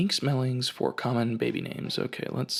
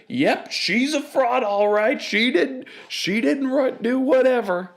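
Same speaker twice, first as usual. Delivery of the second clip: heckling guy; 13 semitones higher, 6 dB louder than usual. Retold or imitated. Audience guy calling Miss Morgan a fraud, high and loud. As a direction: jeer at her from across the room. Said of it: "this guy from the other side".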